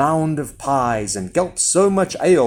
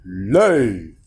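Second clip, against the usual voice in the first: low giant command; lower-pitched giant's order; 5 semitones lower than usual. A narrator's reading. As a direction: command it low, drawing out the word. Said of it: "every time the giant said".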